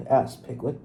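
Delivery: ordinary delivery, nothing marked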